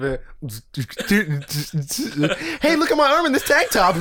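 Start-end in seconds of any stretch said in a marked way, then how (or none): 2.6-4.0 mock voice. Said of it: "you can hear them".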